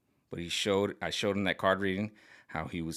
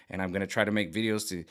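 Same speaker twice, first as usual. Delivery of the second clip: ordinary talk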